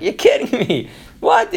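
high, disbelieving yelp of surprise; incredulous Sarah. Retold or imitated. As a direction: jump up in pitch on What, she can't believe it. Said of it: "And Sarah's like".

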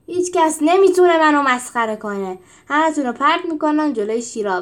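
loud threatening yell; angry shout. Acted. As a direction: bark the threat at the whole troop. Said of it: "سپتیموس داد زد".

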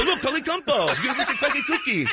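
high, laughing exclamation; mock triumph. Comedian pointing out the student on the cover, high mock triumph. Gleefully shows the newspaper cover.

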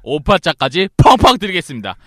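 infomercial. Acted. Infomercial closing pitch.

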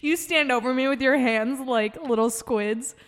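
talking through laughter; giggling as you speak; laughing. Real, not acted. Both laughing at image.

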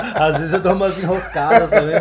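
laughing. Real, not acted. Laughs off the compliment.

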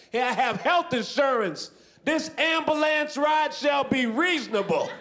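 high, slow pompous declaration; mock grand proclamation. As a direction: proclaim it high and drawn out, like a decree. Mock proud announcement to ambulance crew.

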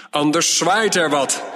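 a king thundering at his wise men; bellowing king. A narrator's reading. King thunders at his advisers, bellowing threat. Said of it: "bulderde de koning".

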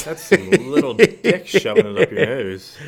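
cracking up mid-sentence, loud and slow; laughing. Real, not acted. To his co-host about a crude image, laughing loudly. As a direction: laugh the words out, barely holding it together.

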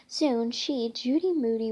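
plain reading voice

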